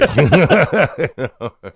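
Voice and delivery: high, loud laugh bursting out; real burst of laughter. Real, not acted. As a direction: crack up at the joke. Rabbi's Paul Newman joke.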